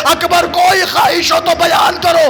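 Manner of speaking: high, loud grieving chant of the tale; wailing lament recitation. Acted. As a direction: cry the scene out to the mourners. Father's plea in lament. (ऊँची आवाज़ में मातमी मरसिया ख़्वानी)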